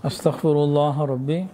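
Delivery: slow, measured Arabic recitation in short phrases; leading repeat-after-me prayer. Real, not acted. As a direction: lead them word by word, drawn out, pausing for response. Leads congregation in repentance formulas.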